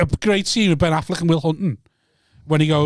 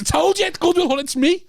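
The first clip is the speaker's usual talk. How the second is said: high, insistent protest; higher and pushing, defend yourself; insisting to mates he's the hero; excited; 12 semitones higher than usual. Real, not acted. Insisting he's Will Hunting.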